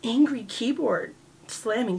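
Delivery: usual speaking voice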